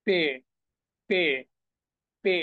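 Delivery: পে with a long "ay" vowel; German-style পে, vowel held; German letter name পে. Shown as a demonstration. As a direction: say পে as German P, "peh". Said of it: "সেটাকে তারা বলে পে".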